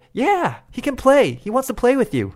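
eager dad. Retold or imitated. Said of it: "他爸就很积极的说".

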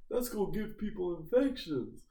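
high-pitched mock indignation; imitated angry complaint. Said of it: "that made him mad".

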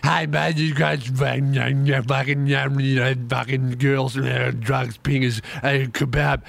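low run of garbled nonsense syllables; incoherent drugged clubber. Retold or imitated. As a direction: imitate a wasted clubber babbling into your ear. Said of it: "so they can't speak English".

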